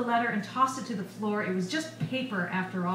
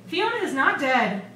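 louder, higher insistence; vehement denial; 5 semitones higher, 6 dB louder than usual. A narrator's reading. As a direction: refuse outright to accept it. Pounds fist, denies her death.

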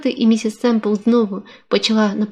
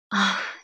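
a quiet sigh of surrender; soft sigh; 8 dB quieter than usual. A narrator's reading. Woman giving in to his embrace, sighing softly. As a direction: give up with a soft breathy ах. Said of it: "зітхнула вона".

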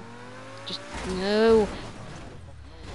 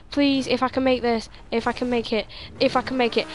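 ordinary talk

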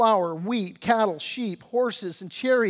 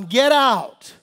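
a louder, forceful command to get out; preacher voicing God's urgent call to leave the city, loud; 3 semitones higher, 7 dB louder than usual. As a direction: order them out of Babylon. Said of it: "the Lord says".

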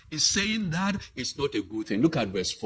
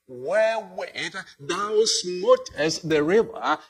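louder, measured Bible-reading voice, slowing at the end; scripture reading; 4 semitones higher, 5 dB louder than usual. A narrator's reading. Preacher reads the Lord's words to the congregation, solemn reading voice. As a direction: read it out solemnly to the church.

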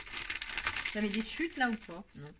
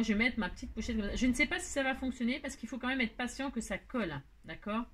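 normal talking voice

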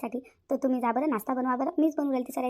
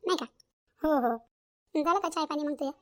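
usual speaking voice

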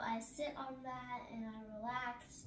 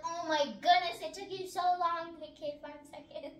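loud, high, exasperated oh my goodness; hurry-up exasperation, much louder; 5 semitones higher, 9 dB louder than usual. Retold or imitated. Exasperated at slow drinking friend.

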